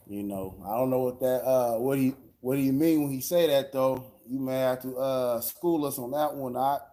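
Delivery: ordinary talk, nothing marked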